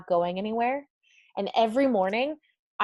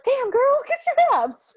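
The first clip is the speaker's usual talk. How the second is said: much higher, louder, slow and gushing; mock hyped praise; 8 dB louder than usual. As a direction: hype her up like a cheering bestie. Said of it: "And I was like".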